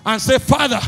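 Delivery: loud, high, driving sermon voice; preaching at full force. Acted. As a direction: hammer each line at the crowd.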